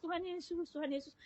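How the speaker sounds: very soft mimicked plea; retold tearful calling. Dying patient calls Jesus at night, imitated quiet crying. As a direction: softly imitate someone weeping out a name. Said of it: "dia nangis".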